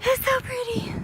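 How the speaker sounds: high, slow, amazed at the view; awed. Real, not acted. Taking in the view from hill.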